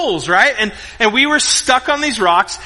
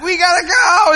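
high, strained mock holler; retold panicky dad shout; urgent yell; 10 semitones higher, 4 dB louder than usual. Dad hollering back to pleading kids, high urgent mock yell.

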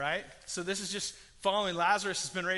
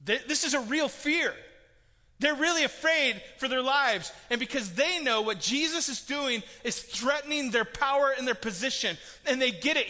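louder, higher urgent proclamation; impassioned preaching; 5 semitones higher, 6 dB louder than usual. Real, not acted. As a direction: drive it home with urgency. Passionate preaching peak.

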